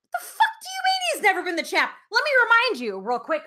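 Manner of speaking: high-pitched, loud outrage; indignant fan setting critics straight. Real, not acted. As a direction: fire back in loud disbelief. Indignantly answers critics' claim.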